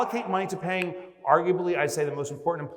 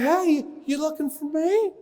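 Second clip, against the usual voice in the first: cheeky turtle head; 10 semitones higher than usual. Pretend. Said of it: "It's like".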